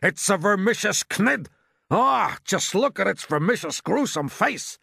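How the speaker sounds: high, loud, drawn-out dread; horrified cry. A narrator's reading. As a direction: cry out in horror at the sight. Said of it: "cried the chief of police".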